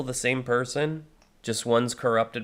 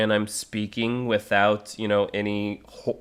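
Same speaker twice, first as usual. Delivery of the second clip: ordinary delivery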